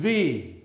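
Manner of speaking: "the" said slowly and drawn out as "thee"; emphasized "the". Shown as a demonstration. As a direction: stretch "the" to show the emphasis. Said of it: "emphasize the word the by saying the".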